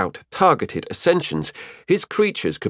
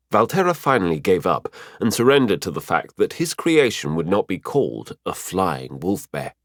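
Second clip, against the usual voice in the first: ordinary delivery, nothing marked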